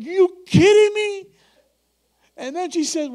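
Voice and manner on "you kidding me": slow, high and loud stunned question; stunned disbelief. Retold or imitated. Preacher handed more money, stunned. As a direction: drag it out in amazement. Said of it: "I'm like".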